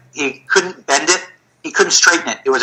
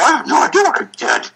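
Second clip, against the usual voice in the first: much higher, incredulous squeak; baffled; 11 semitones higher than usual. Retold or imitated. Therapist telling his trainer he is clueless, high baffled. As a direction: pitch leaps up in helpless bafflement.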